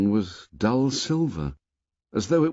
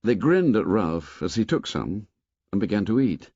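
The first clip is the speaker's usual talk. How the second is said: ordinary delivery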